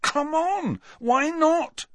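high-pitched demanding protest; mildly acted, high insistence. A narrator's reading. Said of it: "demanded Harry".

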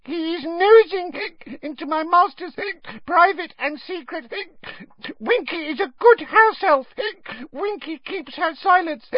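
very high, halting squeak full of hiccups; squeaky protest broken by hiccups; tipsy, indignant elf. A narrator's reading. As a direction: rant drunkenly as a tiny house elf. Drunk Winky rants through hiccups.

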